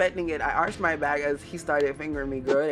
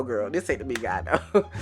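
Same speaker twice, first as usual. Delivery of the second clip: normal talking voice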